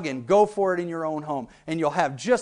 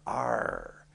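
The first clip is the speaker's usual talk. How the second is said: pirate growl; 7 semitones lower, 10 dB quieter than usual. Shown as a demonstration. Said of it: "[name] on it".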